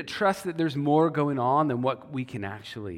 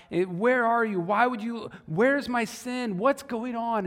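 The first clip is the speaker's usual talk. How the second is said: raised, pleading questions thrown at God; frustrated Job; 7 semitones higher than usual. Retold or imitated. Job hurling questions at God, frustrated and raised. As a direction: voice Job's frustrated demands.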